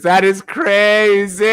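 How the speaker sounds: loud, high, long-drawn exclamation; exclaiming in disbelief. Real, not acted. Reacting to Dixon's message, drawn-out exclamation. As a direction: stretch CRAZY out loud and high.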